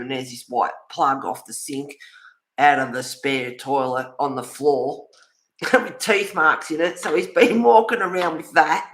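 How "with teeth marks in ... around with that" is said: amused, laughing. Real, not acted. Amused about chewed plug.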